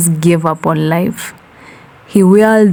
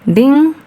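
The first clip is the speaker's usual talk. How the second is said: high chime-like ding; ding sung like a bell; sung elevator ding; 8 semitones higher than usual. A narrator's reading.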